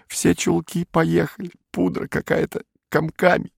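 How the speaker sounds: high-pitched plaintive lament; whiny, tearful. A narrator's reading. Beatrice fears being driven away, whining tearfully. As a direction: pour out your woes in a high, tearful whine. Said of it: "сказала она вдруг плаксиво".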